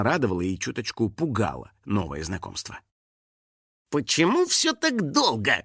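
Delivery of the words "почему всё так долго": high, slow, put-on indignant voice; Финик, indignant. A narrator's reading. Impatient friend berating party helpers, indignant. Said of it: "громко возмущался Финик".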